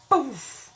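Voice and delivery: a high, loud little "poof" sound effect; playful sound effect of something blowing up; mock explosion. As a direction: pop it out like a small blast. Mimicking a tower toppling.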